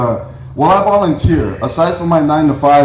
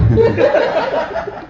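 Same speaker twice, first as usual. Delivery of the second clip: high burst of laughter. Real, not acted. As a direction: laugh high at your own joke. Comic laughs at own joke.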